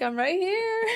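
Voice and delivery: high, loud reply through laughter; laughing call. Retold or imitated. Mom answering her panicked child in a retold story, laughing call. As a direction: call it out while cracking up.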